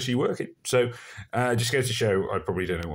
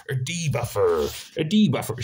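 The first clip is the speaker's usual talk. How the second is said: Somerset accent; 6 semitones higher, 5 dB louder than usual. Shown as a demonstration. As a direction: lean hard into a rural Somerset burr. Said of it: "Somerset people".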